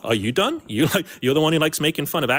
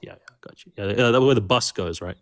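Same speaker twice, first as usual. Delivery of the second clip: sarcastic; 6 semitones lower than usual. Acted. Mocking fake familiarity.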